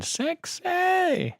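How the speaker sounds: high, slow, drawn-out cheer; excited shout. Real, not acted. Streamer cheering the new boot screen in a high, slow shout. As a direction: belt it out in delight at the screen.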